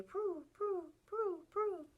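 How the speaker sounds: high, soft cooing prue; pigeon coo. Shown as a demonstration. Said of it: "They say".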